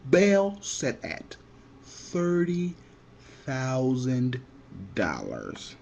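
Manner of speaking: slow, drawn-out announcement of the bail; dramatic slow reveal. Retold or imitated. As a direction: let each word drop slowly, with long pauses. Bail amount announced, dramatic pause.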